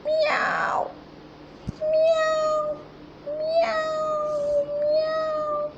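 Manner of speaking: high mewing cat imitation; playful high cat meows for the class. Shown as a demonstration. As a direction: meow like a kitten, high and drawn out. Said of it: "cat makes a sound".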